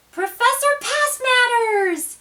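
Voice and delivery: high, slow amazed call; surprised gasp. A narrator's reading. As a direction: gasp the name in wonder. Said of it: "gasped Imogene".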